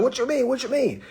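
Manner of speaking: high, puzzled what you mean; husband baffled by the trust; confused husband. Retold or imitated. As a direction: squeak out his confusion. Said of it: "He gonna be like".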